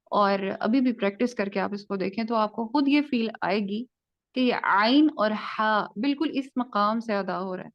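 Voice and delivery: normal talking voice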